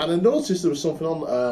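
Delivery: normal talking voice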